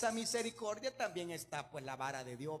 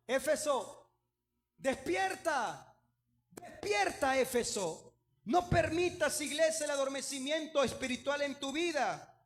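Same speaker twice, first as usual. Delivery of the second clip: higher, louder rousing cry; urgent call; 5 semitones higher, 4 dB louder than usual. Acted. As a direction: wake the church up. Apostle's urgent call to church. (llamado urgente y fuerte: ¡despierta!)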